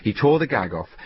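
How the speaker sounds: ordinary delivery, nothing marked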